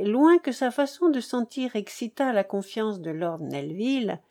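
ordinary delivery

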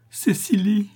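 high, breath-filled rapture; inexpressible exaltation. A narrator's reading. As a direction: sigh the beloved's name high in ecstasy. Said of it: "avec une exaltation inexprimable".

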